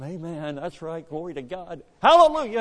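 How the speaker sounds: amens that swell into a loud high hallelujah; mock amens building to a shout. Dad cheering on a visiting preacher, building. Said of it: "He was with him".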